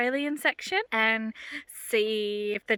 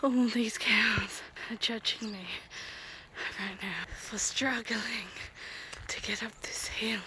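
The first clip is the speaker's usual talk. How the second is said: whispering; 5 dB quieter than usual. Real, not acted. Hiking past cows, whispering.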